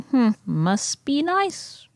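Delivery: slow, high, sour "must be nice"; snide lion. Acted. Lion girl sulking to her spiders, slow and sour. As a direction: drag it out, jealous and snide. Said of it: "and it's like".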